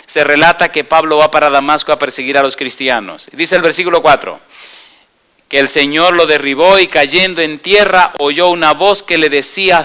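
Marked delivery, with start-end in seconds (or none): none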